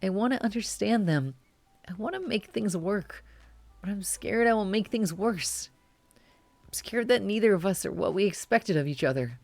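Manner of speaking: put-on a nervous teen calling mom, holding back tears; anxious young caller. Caller voice continues.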